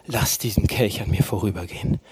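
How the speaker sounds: low, hushed plea, quoting Jesus; a retold cry held down soft and low; subdued anguished cry. Preacher voicing Jesus in Gethsemane, subdued anguished plea. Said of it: "er schreit".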